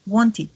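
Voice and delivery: "Wanted" ending in a soft "id", said "wonti"; "Wanted" con final suave. Shown as a demonstration. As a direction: let "Wanted" close on a gentle "i" sound. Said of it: "sino como una I".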